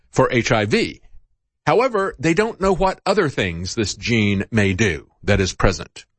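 ordinary delivery

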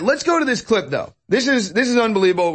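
ordinary talk, nothing marked

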